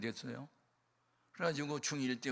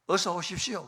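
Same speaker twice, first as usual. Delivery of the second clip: exaggeratedly polite welcome, louder and higher; mock cheerful welcome; 7 semitones higher, 10 dB louder than usual. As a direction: ham up the bright greeting. Mock welcoming his attacker.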